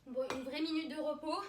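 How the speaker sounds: ordinary delivery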